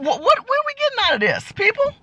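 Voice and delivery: high disbelieving appeal; exasperated plea. Real, not acted. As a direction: throw up your hands at the crowd.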